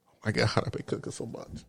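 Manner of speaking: breathy whisper, trailing away. Real, not acted. Vlogger whispering how much she's been cooking. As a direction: let it fade to a whisper.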